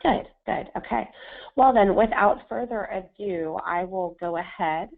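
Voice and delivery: ordinary delivery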